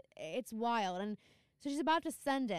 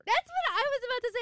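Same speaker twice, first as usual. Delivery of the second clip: excited; 8 dB louder, faster than usual. Real, not acted. Excited agreement, loud and high.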